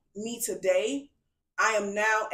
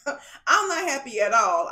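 laughing; 4 semitones higher, 5 dB louder than usual. Real, not acted. Laughing at her own sad phase.

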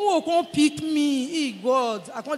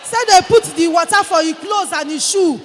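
louder retelling of the shoe story; loud telling; 4 semitones higher, 8 dB louder than usual. Real, not acted. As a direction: announce it to the hall.

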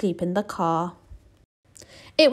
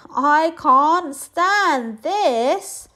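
very slow, higher, louder complaint; exasperated bear; 9 semitones higher, 6 dB louder, much slower than usual. Acted. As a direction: drag it out, fed up. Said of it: "said Mister Bear".

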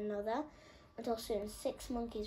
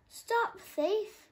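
high, louder bellow; 12 semitones higher, 7 dB louder than usual. A narrator's reading. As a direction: bellow after the thief. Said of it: "bellowed Herman".

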